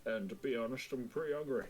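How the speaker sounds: talking through a mouthful of potato; chewing while talking; mouth full. Acted. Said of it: "He says that with a mouth full of potato".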